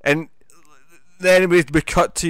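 ordinary talk, nothing marked

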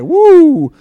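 high, loud imitated "woo"; congregation's whoop. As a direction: whoop it like an excited crowd. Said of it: "they were like".

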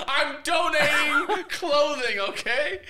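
high, loud, slow whiny protest; mock defensive guest. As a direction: play the cornered talk-show guest. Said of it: "I can see you on Doctor Phil".

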